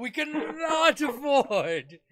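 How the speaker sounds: mock outraged Satanist; indignant outrage. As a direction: protest hotly, climbing to a yell. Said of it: "this one guy going".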